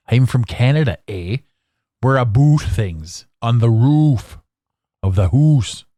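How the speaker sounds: lightly put-on Canadian accent, hoose for house; a mock Canadian drawl, slow. Podcaster mocking Canadians, mild Canadian accent. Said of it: "Do I have an accent?".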